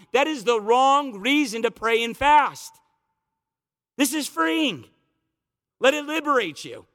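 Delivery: a pastor urging his flock to pray freely; fervent preaching. Acted. Preacher building to the climax.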